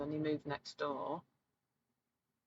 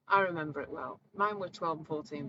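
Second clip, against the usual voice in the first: normal talking voice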